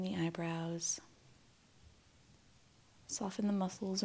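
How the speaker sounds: calm meditation voice with long, restful pauses; guided meditation. Acted. Guide asking listeners to relax and breathe, calm meditation voice. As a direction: ease them gently toward stillness, leaving space to breathe.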